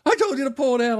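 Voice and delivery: high, pained imitation of a father; mock angry scolding. Hurting father berating his son over the nail, high angry yell.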